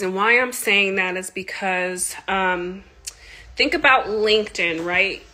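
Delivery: usual speaking voice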